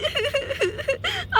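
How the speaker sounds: a thin, high sob, held back; high soft crying wail. Acted. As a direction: weep high and quietly, like the bride. Bride crying at farewell. (भाभी से लिपटकर दुल्हन की ऊँची धीमी सिसकी)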